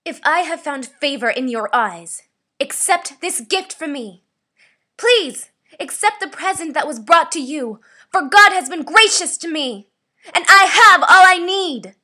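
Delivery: furious, very loud shouting building to the end; angry. Shown as a demonstration. Voice actor demanding a gift be accepted, angry shouting. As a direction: shout it in rising anger. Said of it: "This is my angry read".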